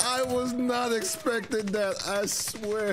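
high, slow stunned words; real stunned surprise, pitch high; shocked and amused. Real, not acted. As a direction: disbelief after the crash. Laughing shock after crash.

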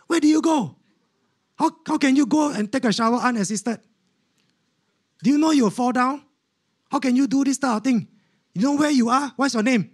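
high-pitched incredulous scolding; scolding nurse. Retold or imitated. Nurse scolding patient for showering unassisted, high and incredulous. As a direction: play a flustered nurse firing off questions. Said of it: "the nurse looked at me".

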